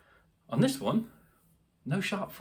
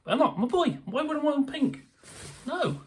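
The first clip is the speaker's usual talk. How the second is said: high, hammed-up outrage; mock protest, pitched high; mock indignant; 11 semitones higher than usual. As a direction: play the offended little boy. Mock indignant about pink.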